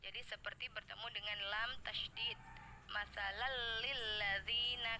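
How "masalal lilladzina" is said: "lillazina" with a short pressed lam; slow recited merge; idgham demo. Shown as a demonstration. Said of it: "penekanannya yang tidak lama".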